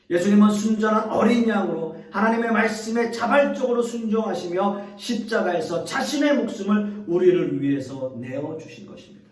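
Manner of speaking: ordinary talk, nothing marked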